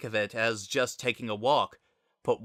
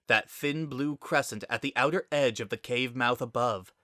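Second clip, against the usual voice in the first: ordinary delivery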